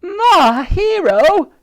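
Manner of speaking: loud, high, drawn-out swooning cry; adoring cheer. A narrator's reading. Dottie praises Titch after his hit, adoring cheer. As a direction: gush it at top pitch.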